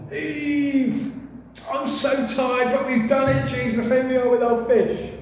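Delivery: lightly voiced tired disciple arriving with the catch; weary fisherman. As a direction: drag the words like you fished all night. Tired fishermen greet Jesus on shore.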